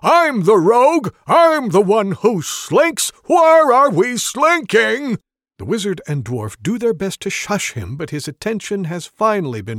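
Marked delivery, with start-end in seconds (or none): 0.0-5.2 high, loud, slowed outrage; indignant shouting. Acted. Rogue claiming the slinking job, indignant shouting. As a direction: protest like a wounded professional.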